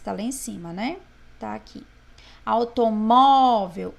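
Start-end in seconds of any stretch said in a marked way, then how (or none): 2.4-3.9 Automóvel, slow, with MÓ loud and long; stress on MÓ. Shown as a demonstration. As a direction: boom out the MÓ in Automóvel. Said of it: "o mó". (Automóvel devagar, com o mó bem forte)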